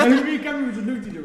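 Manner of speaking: loud, high, fast talk through laughter; real laughing. Real, not acted. Storyteller cracking up at the bad man's boast, laughing. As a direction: burst out laughing and keep telling it.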